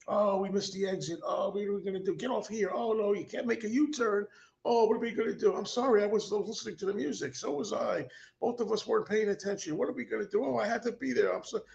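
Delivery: high, frantic mock panic in the car. Host reliving missing the highway exit, frantic high mock panic. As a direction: squeal it like you just missed the exit. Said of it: "And then it's like".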